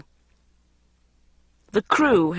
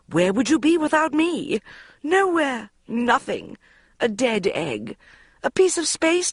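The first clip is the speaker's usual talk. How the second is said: guilt-tripping mother; 9 semitones higher than usual. A narrator's reading. Mother guilt-tripping Bridget into filming, high and dramatic. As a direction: lay the guilt on thick.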